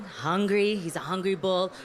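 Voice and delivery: ordinary talk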